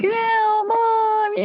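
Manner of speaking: high, drawn-out kiddie whine; whining toddler. Acted. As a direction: whine like a toddler pestering a parent. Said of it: "toddlers that whine, they're like".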